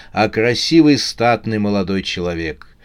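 usual speaking voice

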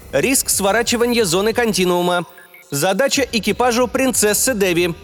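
cold, official ship voice, high; spaceship. A narrator's reading. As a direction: rap it out like an official machine. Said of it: "холодно официальный голос корабля отчеканил".

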